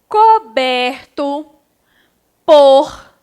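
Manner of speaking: loud, slow, each word set apart for pupils; slow word-by-word reading. Shown as a demonstration. Teacher reading the circled words aloud for pupils, slow and loud. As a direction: read every word aloud slowly so the class can follow. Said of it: "Então, vamos comigo".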